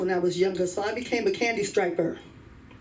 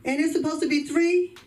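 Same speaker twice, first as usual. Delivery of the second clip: higher, cheeky question to the genie; sassy challenge; 7 semitones higher than usual. Retold or imitated. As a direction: call the genie out, raised and sassy. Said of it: "And I said".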